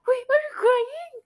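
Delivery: mock the friend's surprise, pitch way up; surprised friend. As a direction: friend noticing the tears, surprised. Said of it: "sabi niya".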